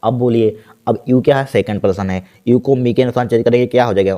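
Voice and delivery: ordinary talk, nothing marked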